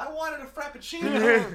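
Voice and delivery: mock a friend griping over the wrong coffee; hammed-up petulant whine; whiny complaint. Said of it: "I was like".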